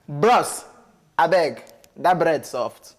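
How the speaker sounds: slow, put-on Igbo accent; drawn-out Igbo-accented pidgin plea. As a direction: beg in a Nigerian Igbo accent. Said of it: "Igbo accent".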